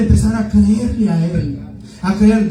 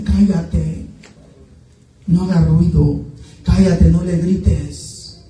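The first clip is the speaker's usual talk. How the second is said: lower-pitched hushing reprimand, retold; retold rebuke; 4 semitones lower, slower than usual. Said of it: "Y le reprendían". (reprensión relatada, en tono más grave)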